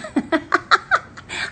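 airy laugh, no voice; breathy burst of laughter. Real, not acted. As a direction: she laughs at her son's joke about towing her home, an airy laugh. Laughing at son's tow offer.

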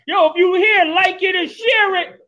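high-pitched hype-DJ sign-off. Shown as a demonstration. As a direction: call it out like a DJ on the mic. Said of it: "Do your D J voice".

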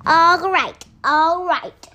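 pirate captain grudgingly giving in; shrieking captain. A narrator's reading. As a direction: shriek it in exasperation. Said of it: "shrieked the captain".

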